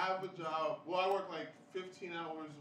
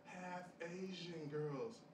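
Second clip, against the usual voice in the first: slow reveal; 5 dB quieter, much slower than usual. Retold or imitated. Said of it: "he goes".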